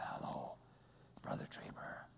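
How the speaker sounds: imitating a man with no voice box; breathy, slow, toneless whisper; voiceless whisper. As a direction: rasp it out with no voice at all. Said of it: "His voice box has been removed".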